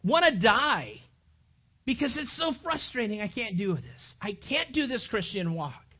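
loud frustrated outburst. Acted. Paul venting frustration at his failures. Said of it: "He's so frustrated".

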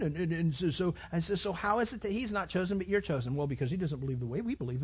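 ordinary talk, nothing marked

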